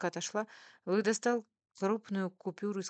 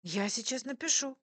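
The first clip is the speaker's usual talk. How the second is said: put-on high, eager girlish delight; light up and offer it eagerly; joyful; 8 semitones higher, 4 dB louder than usual. A narrator's reading. Waitress delighted to share her recipe, joyful. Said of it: "радостно сказала девушка".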